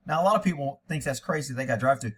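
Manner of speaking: ordinary talk, nothing marked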